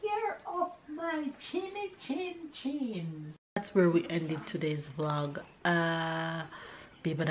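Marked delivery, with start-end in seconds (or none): 0.0-3.3 high squeaky piglet voice; little pigs voice. Acted. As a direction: cry it out like tiny pigs. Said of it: "cried the pigs".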